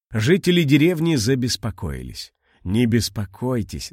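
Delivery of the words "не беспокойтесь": ordinary delivery, nothing marked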